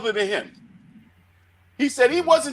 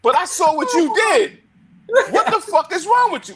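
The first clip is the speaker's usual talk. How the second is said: retold scolding boss; 8 semitones higher than usual. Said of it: "He said".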